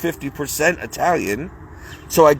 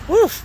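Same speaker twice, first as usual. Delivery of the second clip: sharp woof exclamation. Real, not acted. Exclaims at the cold.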